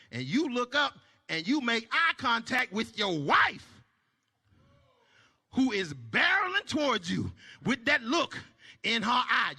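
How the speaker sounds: ordinary delivery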